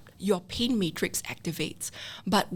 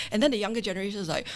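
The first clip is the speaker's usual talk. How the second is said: normal talking voice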